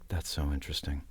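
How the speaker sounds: low, soft imitation of absorbed director; quiet musing. Actor retells director absorbed in filming, quiet musing. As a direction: murmur it, retelling his fascination.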